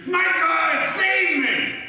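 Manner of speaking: very high, loud cry for help; panicked scream. Retold or imitated. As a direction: scream to be saved.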